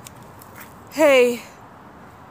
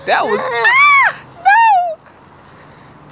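very high, loud startled yell; startled shout; 8 dB louder, much slower than usual. Real, not acted. Startled by the sudden attack, very high and loud. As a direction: jump and shout.

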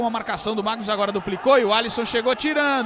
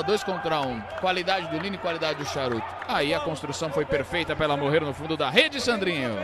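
ordinary talk

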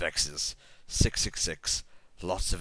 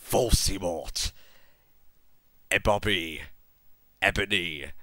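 evil, wrathful voice. A narrator's reading. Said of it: "said evilly in his wrathful voice".

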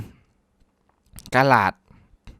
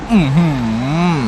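amazed; 4 semitones higher, 6 dB louder than usual. Real, not acted. Amazed at the motorbike drift.

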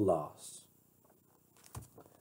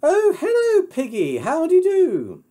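very high, loud, gushing greeting; 8 dB louder than usual. Acted. Heroine greets Piggy by phone, high and effusive. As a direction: greet a friend in swooping falsetto.

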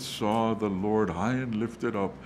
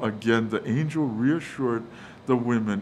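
ordinary talk, nothing marked

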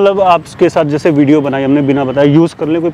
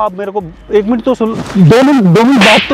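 urgent pleading; 4 semitones higher than usual. Real, not acted. Young man begging an angry auntie for two minutes, urgent. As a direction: beg urgently to be heard. Begging to be heard while chased.

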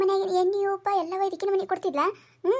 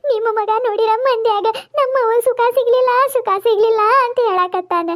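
louder hammed-up lament for the mother-in-law; loud fake wailing; 4 dB louder than usual. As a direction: wail for show, loud and theatrical. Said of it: "ನಾ ಹೆಂಗ್ ಚಂದ ಅಳ್ತೀನಿ".

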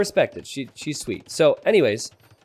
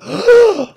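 sharp, high delighted gasp; mock gushing gasp; 10 dB louder than usual. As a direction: gasp as if at a cute dog. Mock gasp at cute dog.

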